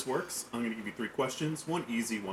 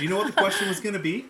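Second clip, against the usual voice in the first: laughing; 9 semitones higher, 8 dB louder, much faster than usual. Real, not acted. Teasing cohost while laughing.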